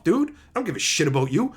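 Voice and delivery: ordinary delivery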